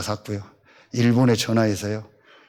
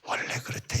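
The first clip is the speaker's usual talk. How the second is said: whispered, much softer; hushed aside; 12 dB quieter than usual. Retold or imitated. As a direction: breathe it like a secret. Retold friend's hushed explanation.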